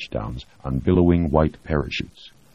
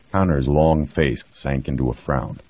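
plain reading voice

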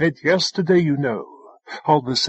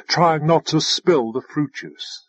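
ordinary delivery, nothing marked

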